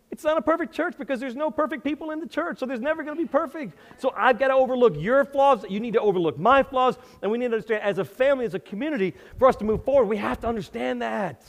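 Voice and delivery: high, loud climb of a preacher; rising sermon fervor; preaching at full force. Acted. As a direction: drive the point home to the congregation. Preaching climax, raised pitch.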